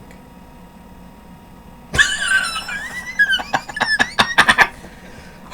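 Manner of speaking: helpless high laughter bursting out; cracking up mid-bit; real laughing fit. Real, not acted. Breaks down laughing mid-story.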